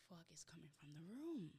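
retold in a soft, voiceless whisper; hushed realisation. Telling friend about a noise in the dorm, whispered. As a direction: whisper it like a private thought. Retelling a stealthy night-time realisation.

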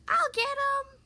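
high, loud eager call; bursting to go after the bucket; eager announcement. A narrator's reading. Eager pup chasing the bucket, calling high and loud. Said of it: "Marshall announced".

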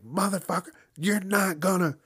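mock angry scolding; mock mad finger-pointing. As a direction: jab the finger and snap it out. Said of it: "when someone's mad and they do that".

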